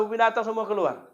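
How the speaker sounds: normal talking voice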